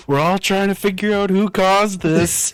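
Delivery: high, loud put-on panicked voice; hot dog guy voice. Voicing the gif guy in the hot dog suit, high and loud.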